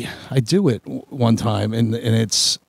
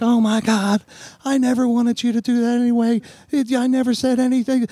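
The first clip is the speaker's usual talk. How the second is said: much higher, imitating the panicked girlfriend; girlfriend's voice; 10 semitones higher than usual. Retelling his girlfriend's reaction to his crying, high imitation. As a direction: pitch way up to play her. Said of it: "And she's like".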